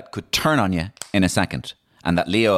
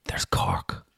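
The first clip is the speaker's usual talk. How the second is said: whispered, reverent punchline; hushed reveal; 6 dB quieter than usual. Acted. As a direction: drop to a whisper for the big reveal. Punchline of the mock-epic build-up.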